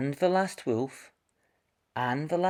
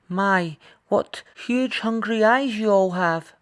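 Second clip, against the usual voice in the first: put-on higher, louder teasing little girl; Red's voice; 10 semitones higher, 5 dB louder than usual. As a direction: tease the hungry animals like a bold girl. Red to the hungry animals.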